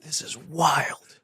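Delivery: hushed, slow whisper; awed whisper. Real, not acted. Host whispers in awe at the guest's story. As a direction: breathe it out in disbelief.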